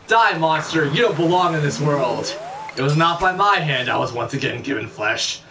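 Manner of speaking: theatrical recital of a monster confrontation; dramatic game quote. Acted. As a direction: declaim both sides of a melodramatic showdown. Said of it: "I'm gonna quote it".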